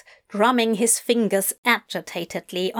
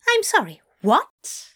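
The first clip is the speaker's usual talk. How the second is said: high, bewildered fussy voice; baffled angel voice; 7 semitones higher than usual. A narrator's reading. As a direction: ask in baffled disbelief.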